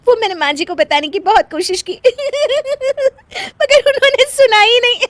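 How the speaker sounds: high, rising complaint broken by laughs; explaining through laughter. Acted. Wife telling husband nobody listened, laughing helplessly. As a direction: struggle to explain while giggling.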